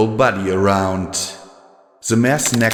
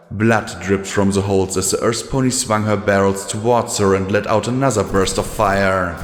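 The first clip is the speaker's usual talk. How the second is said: plain reading voice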